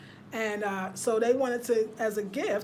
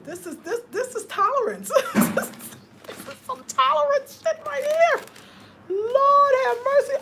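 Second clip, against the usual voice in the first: high, loud laughing exclamation; laughing disbelief; 14 semitones higher, 6 dB louder than usual. Real, not acted. Vlogger laughing about having to be tolerant of the gift.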